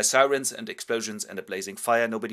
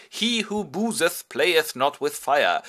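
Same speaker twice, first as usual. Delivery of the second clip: slow, pompous, higher proclaiming voice; mock proverb; 6 semitones higher, 6 dB louder, slower than usual. Biedermann lecturing the audience in a slow pompous intone. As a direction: intone it like an old saying.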